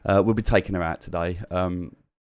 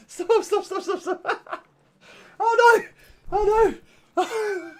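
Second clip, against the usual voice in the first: much higher, giggly gushing at an overexcited dog; laughing pet talk. Real, not acted. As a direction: squeal through laughter at the puppy. Dog bounds upstairs, owner laughs.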